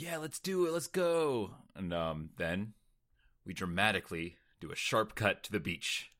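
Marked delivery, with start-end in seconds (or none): 0.0-1.5 excited quote. Retold or imitated. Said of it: "he's like".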